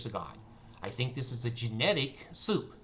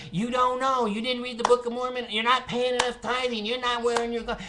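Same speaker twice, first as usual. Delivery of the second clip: high, louder mock lecturing voice; shrill mimicry of a scolding believer; 11 semitones higher, 4 dB louder than usual. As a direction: parody the nagging church elder. Mocking how Mormons lecture him.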